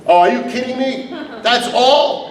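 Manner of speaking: ordinary delivery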